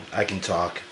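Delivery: quiet raspy voice; worn-out voice; hoarse voice. Real, not acted. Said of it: "I've lost my voice".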